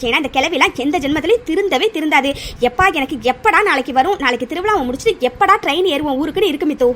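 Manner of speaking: ordinary talk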